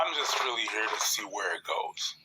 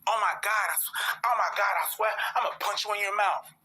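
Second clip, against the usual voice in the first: imitating girls; 8 semitones higher than usual. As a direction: play a girl half-joking a threat, pitched up. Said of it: "girls do the same shit".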